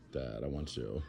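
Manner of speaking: puppy voice. Pretend. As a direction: speak for the puppy in a small put-on voice. Voicing the puppy's thoughts.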